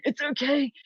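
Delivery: high-pitched mock brave front; wobbly, squeaky self-mockery, badly faked composure; pretending to be unaffected. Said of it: "pretend it doesn't affect me".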